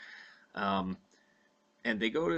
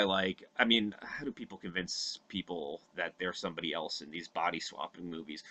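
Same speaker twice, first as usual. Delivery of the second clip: ordinary talk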